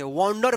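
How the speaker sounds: वंडर said "wawn-dar"; correct "aw" vowel. Shown as a demonstration. Teacher telling learners what to read, with aw. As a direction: round the first vowel of वंडर to "aw". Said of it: "वंडर पढ़ेंगे".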